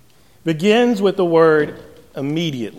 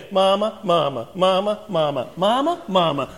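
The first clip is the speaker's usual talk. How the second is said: put-on nagging kid. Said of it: "They get all these different pitches".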